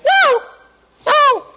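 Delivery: put-on high whiny child's no; hammed-up toddler refusal; defiant child. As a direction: pout it like a small kid. Child refusing, parent story.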